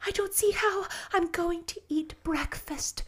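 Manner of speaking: put-on high, dreamy girlish delight; rapturous. A narrator's reading. Anne is too thrilled to eat breakfast, rapturous. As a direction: sigh it out in bliss. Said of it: "said Anne rapturously".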